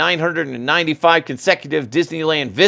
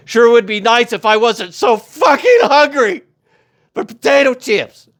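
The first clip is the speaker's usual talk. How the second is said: mock hungry complaint; 8 semitones higher, 5 dB louder than usual. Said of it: "you're like".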